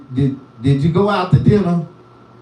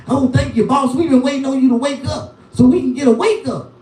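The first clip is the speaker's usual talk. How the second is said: much higher, fawning and quick; eager crackheads; 10 semitones higher, faster than usual. Retold or imitated. Crackheads greeting the boss as he wakes, high and fawning. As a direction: gush it like eager hangers-on. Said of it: "They be like".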